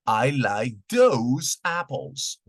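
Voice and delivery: "I like those apples" said slowly, falling on "those"; slow model sentence. Shown as a demonstration. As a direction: stress "those" in a slow teaching read. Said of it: "Un ejemplo sería".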